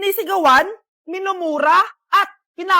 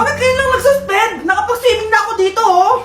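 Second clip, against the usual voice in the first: high, loud, fast complaint; exasperated protest; 4 semitones higher, 6 dB louder than usual. Acted. Soaked student protesting friends' suspension news, high loud exasperation. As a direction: wail at them in comic outrage.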